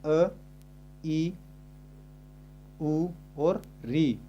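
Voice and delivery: अ, ई, उ, री drawn out slowly, one by one; slow vowel recital. Shown as a demonstration. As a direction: spell out each short vowel slowly for students. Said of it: "कौन कौन सी है?". (धीरे धीरे स्वर गिनाना)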